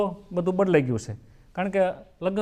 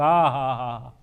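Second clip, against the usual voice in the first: heavy sigh; 8 semitones lower, 3 dB quieter, much slower than usual. Acted. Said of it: "મણેકનો નિયાકો નાખ્યો".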